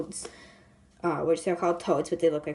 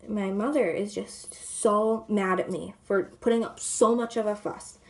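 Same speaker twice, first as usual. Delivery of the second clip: normal talking voice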